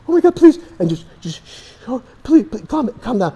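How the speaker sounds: high, frantic begging, then hushed shushing; terrified mother begging a gunman and hushing her baby; panicked pleading mother. Pretend. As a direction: beg for mercy while shushing a baby. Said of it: "So it's gonna make this".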